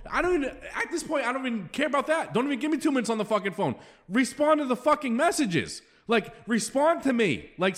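real exasperated rant, pitched high; frustrated. Real, not acted. Host begging league members to answer his texts, frustrated. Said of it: "I'm just so fucking frustrated".

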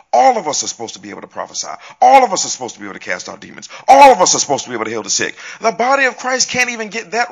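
fast, loud preaching, building in volume; preaching at full force. Acted. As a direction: hammer each line like a pulpit climax. Preaching burst on believers' power.

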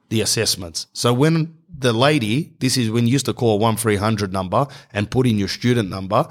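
usual speaking voice

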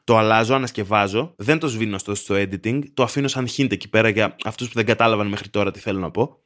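ordinary talk

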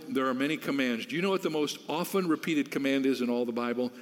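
ordinary delivery

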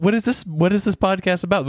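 ordinary delivery

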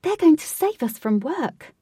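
high, loud cry from the crowd; crowd shout. A narrator's reading. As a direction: shout it out as a cheering onlooker. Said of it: "shouted some".

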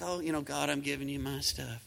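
soft, mumbled, half-hearted prayer; limp offhand prayer. Pretend. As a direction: toss it off like it barely matters. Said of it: "it's not like".

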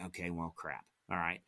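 deflated mutter. Retold or imitated. Retold disappointment, finding nothing.

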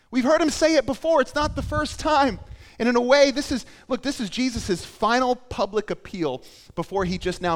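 high, loud, insistent preaching; impassioned preaching. Real, not acted. Preacher on Jesus' final public appeal, loud and impassioned.

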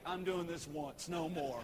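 a lazy dog who can't be bothered. Pretend. Lazy dog refusing to fetch again, low bored mutter. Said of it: "It's like".

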